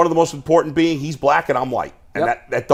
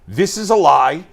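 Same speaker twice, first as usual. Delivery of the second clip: higher-pitched, forceful declaration of innocence; emphatic mock denial; 6 semitones higher, 4 dB louder than usual. Imagining himself in Deshaun's shoes at the mic, emphatic denial. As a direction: declare it as if to a press conference. Acts a defiant public denial.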